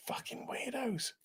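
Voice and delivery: unvoiced whispered aside. Real, not acted. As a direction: hiss it under your breath like a secret jab.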